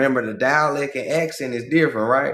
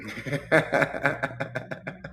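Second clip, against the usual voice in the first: soft chuckling laugh; 6 dB quieter than usual. Real, not acted. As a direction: laugh quietly to yourself. Amused at his own hint.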